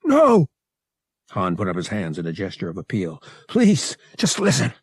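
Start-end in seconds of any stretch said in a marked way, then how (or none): 0.0-0.5 high, loud cry of protest; alarmed plea. A narrator's reading. Han pleading for his life. 3.5-4.7 high-pitched, urgent begging; desperate plea. A narrator's reading. Appeals to his attacker.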